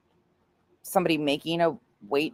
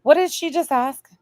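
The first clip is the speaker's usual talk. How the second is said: mock squeak; 10 semitones higher, 3 dB louder than usual.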